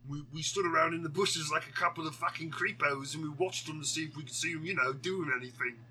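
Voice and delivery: hammed-up, deliberately shoddy British accent; bad British accent. Podcaster voicing creepy onlooker guys, bad British accent. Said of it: "doing a shitty British accent".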